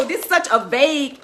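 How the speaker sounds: usual speaking voice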